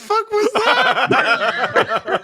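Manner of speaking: high, slow words through real laughter; laughing disbelief. Real, not acted. Reacting to a friend's terrible Scottish accent, laughing. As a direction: crack up while asking what that was.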